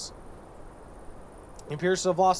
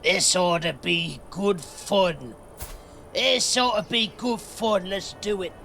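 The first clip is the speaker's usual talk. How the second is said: put-on hunter voice. Grinning lord eager for the hunt, hearty put-on voice. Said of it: "Wayfair grins".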